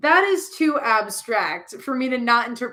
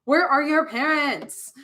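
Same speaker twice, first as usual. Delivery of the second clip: slow, higher, incredulous question; exasperated demand; 5 semitones higher, slower than usual. Real, not acted. Reader demanding where the kids' parents are, exasperated. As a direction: stretch out each word in outrage.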